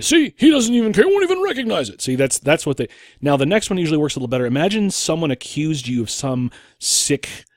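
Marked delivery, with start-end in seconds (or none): 0.0-2.0 high-pitched mock outrage, rising as it goes; hammed-up finger-pointing in a high voice; mock accusing. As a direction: imitate an accuser crowing to the audience. Acts accuser crowing to audience.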